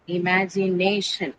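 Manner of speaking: "Imagination" slowly broken into i-ma-gi-na-tion; syllable by syllable. Shown as a demonstration. Teacher shows students how to write it, "Imagination" slowly syllable by syllable. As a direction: spell out "Imagination" by ear, slow, part by part. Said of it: "this is how you will write imagination".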